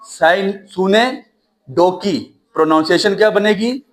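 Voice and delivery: usual speaking voice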